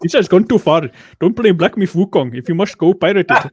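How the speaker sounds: loud mock Indian accent; hammed-up Indian-accented rant; angry Indian accent. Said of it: "he'll be like".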